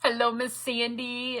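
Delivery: normal talking voice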